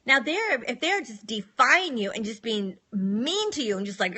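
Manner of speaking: normal talking voice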